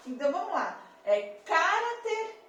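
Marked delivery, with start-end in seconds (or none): 1.4-2.4 caráter misstressed as CÁ-ra-ter, slow and high; stress on first syllable. Shown as a demonstration. Teacher testing options, caráter stressed on "ca". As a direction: hit the "ca" of caráter hard, drawing it out. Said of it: "como é que a palavra saci é pronunciada".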